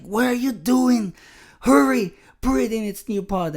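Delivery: high, loud panicked urging; freaking-out wife. Retold or imitated. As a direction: imitate a wife frantically rushing her husband. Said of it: "like, freaking out".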